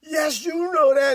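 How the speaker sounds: high, shouted retold accusation; indignant protest. Boyfriend challenging the girls' lies, acted indignant shouting. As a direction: act out a heated comeback.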